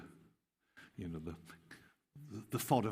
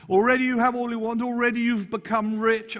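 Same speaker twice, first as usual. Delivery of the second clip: much higher, loud, sarcastic praise; tongue in cheek; 14 semitones higher, 9 dB louder than usual. Acted. Paul ridiculing Corinthian pride, high loud sarcasm. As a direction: lay the sarcasm on thick. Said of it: "Tongue in cheek".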